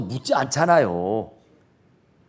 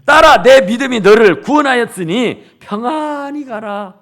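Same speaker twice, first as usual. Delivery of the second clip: 딸아 called out loud at the start, the verse declared louder; proclaimed verse; 3 semitones higher, 7 dB louder than usual. Acted. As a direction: boom out 딸아 and proclaim the whole verse. Preacher proclaims the key verse.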